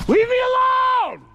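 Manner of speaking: frightened yell to scare off. Real, not acted. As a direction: shout them away. Yelling at stalkers.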